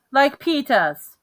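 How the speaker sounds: lightly voiced little boy asking; toddler voice. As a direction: say it like a small child wanting his brother's shoes. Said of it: "he said".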